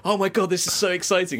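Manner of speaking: high-pitched mock thrill; mock excitement. Imitating a fan between balls, mock excited. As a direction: play an overexcited fan. Mimicking excited cricket watcher.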